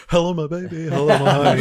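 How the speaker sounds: put-on old time radio voice. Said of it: "That old time radio voice".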